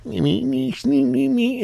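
very high squeaky alien jabber; giggling alien chatter. Retold or imitated. Little Martians giggling at the captive, squeaky chatter. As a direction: imitate tiny Martians tittering way up high. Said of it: "they're giggling and shit".

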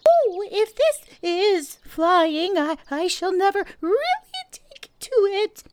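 high, loud, halting little squeal of words; jerky Piglet. A narrator's reading. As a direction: squeak it out in bursts, as if jolted. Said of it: "he thought jerkily to himself".

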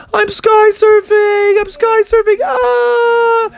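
thrilled frog cry. Acted. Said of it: "And he was like".

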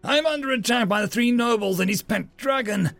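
high-pitched, pressing explanation; urgent alarm. A narrator's reading. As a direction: plead for help under attack. Reporting an attack.

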